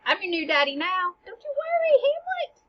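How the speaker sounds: high, teasing stepdad voice; mocking the new king; mock new daddy. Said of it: "he's basically like".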